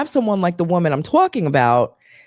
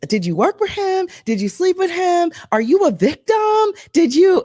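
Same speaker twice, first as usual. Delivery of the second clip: nosy questioners; 12 semitones higher than usual. Retold or imitated. Mimics nosy people's questions.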